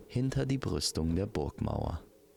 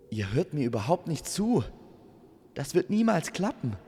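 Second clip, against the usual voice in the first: high, louder, exasperated outburst; exasperated protest; 9 semitones higher, 4 dB louder than usual. Acted. Amor telling Stella it will never work, exasperated. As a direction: protest at not being listened to.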